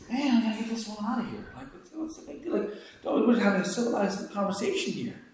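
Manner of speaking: soft, fast, irritated grumble under his breath; muttering Peter. Retold or imitated. Peter grumbling to himself about the noisy woman. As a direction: grumble to yourself about her. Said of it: "Peter thinking".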